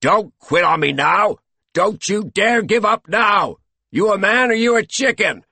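loud, high, furious goading; furious coach. A narrator's reading. Coach berating the slowing master, furious shout. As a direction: roar at a quitter to keep going. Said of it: "Coach was furious".

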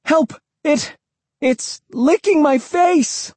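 very high cry for help, drawn out; high, slow wail of alarm, short of full volume; panicked scream. A narrator's reading. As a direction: stretch the words in acted panic. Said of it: "I screamed".

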